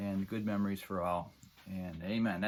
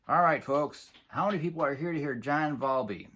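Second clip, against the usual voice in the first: louder emcee call to the crowd; stage announcement; 4 semitones higher, 5 dB louder than usual. Retold or imitated. Drummer addressing the crowd from stage, loud emcee call. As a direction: rev up the audience like a spokesman. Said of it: "he said".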